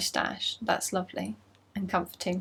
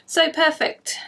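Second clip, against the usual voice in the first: louder, higher burst of delight; 7 semitones higher, 7 dB louder, slower than usual. Real, not acted. Knitter showing viewers her socks, delighted. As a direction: gush over the socks. Admiring finished sparkly socks.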